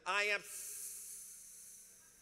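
drawn out, stalling before sorry; prideful stammer. Retold or imitated. Pastor mimicking someone too proud to apologize, stalling slowly. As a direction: stretch it slowly and choke off the word. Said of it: "You be like".

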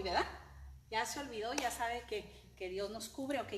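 normal talking voice

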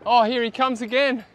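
usual speaking voice

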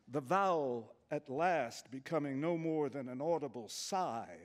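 ordinary delivery

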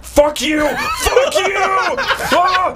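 very high, screamed mock rage; retold furious shout. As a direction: imitate a guard exploding in helpless fury. Retold guard exploding at the prank.

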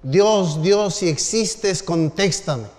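mock anguished shout to heaven; retold shout. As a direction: cry out desperately to the sky. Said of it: "empezó a hacer el mismo grito".

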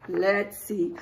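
ordinary talk

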